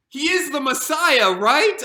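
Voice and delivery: slow, loud and emphatic; a loud slow claim, then a pleading right; loud insistence. Acted. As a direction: plead it as if to convince himself. Insisting to reassure himself.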